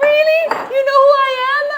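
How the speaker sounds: high, loud, thrilled disbelief; surprised delight. Retold or imitated. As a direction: squeal back in thrilled surprise. She replies to partygoer.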